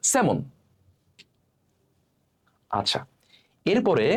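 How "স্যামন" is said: স্যামন with silent l, "se-mon"; correct pronunciation. Shown as a demonstration. Teacher answering how it should sound, correctly pronounced. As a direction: leave the l out entirely in স্যামন. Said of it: "থাকবেই না".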